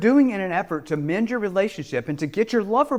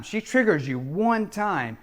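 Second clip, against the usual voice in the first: ordinary delivery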